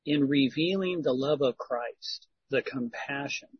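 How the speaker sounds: ordinary talk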